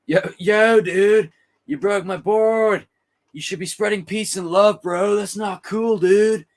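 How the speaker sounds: high laid-back surfer dude. Retold or imitated. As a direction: whine like a chill surfer whose board broke. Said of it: "Great surfer impression".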